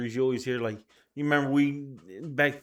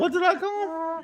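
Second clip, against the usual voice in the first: surprised. Real, not acted. Realizing own slip.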